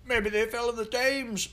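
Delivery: high, teasing Tudor Londoner; mocking onlooker. A narrator's reading. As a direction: tease the children in a high old-time voice. Second onlooker jeers.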